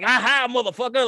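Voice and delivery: loud, high gloating taunt; unmasked cartoon villain. Locals taking the mask off, gloating villain. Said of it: "Like Scooby-Doo, they took the mask off".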